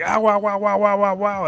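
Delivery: high, loud "wow-wow-wow" howl; muffled pained wailing. Retold or imitated. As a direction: wail as if your jaw is held. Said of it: "as he holds his jaw".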